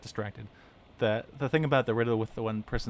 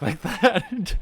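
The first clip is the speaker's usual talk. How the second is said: higher, laughing, slow; amused at the voice; 9 semitones higher, 8 dB louder than usual. Real, not acted. Player laughing at the game character's speech.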